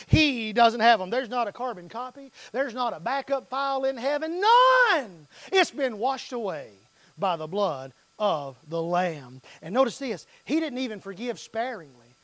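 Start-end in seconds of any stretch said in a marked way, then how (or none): none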